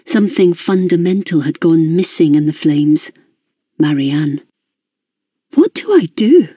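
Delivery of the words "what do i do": high, desperate plea. A narrator's reading. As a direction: beg for a way forward, pitched high. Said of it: "desperate suddenly to move forward".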